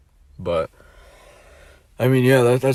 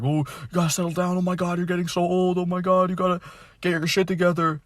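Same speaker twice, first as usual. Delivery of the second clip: much higher, exasperated mock parent; mimicking parents; 9 semitones higher than usual. Vlogger imitates his parents pressuring him to settle down, high mock nag. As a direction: parody parents' "oh my God" nagging. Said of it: "my parents".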